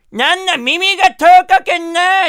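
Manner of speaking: high, loud Kumamoto granny; old farming granny. Retold or imitated. Granny asks receptionist where rakugo is, loud old-woman voice. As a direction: badger loudly like a hard-of-hearing old woman. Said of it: "そのおばあちゃんが".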